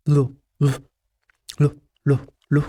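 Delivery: لو as a short "lu", tongue curled; letter L sound. Shown as a demonstration. Teacher asking pupil to repeat after her, short L sounds. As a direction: repeat لو in short separate beats. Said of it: "قول ورايا كده".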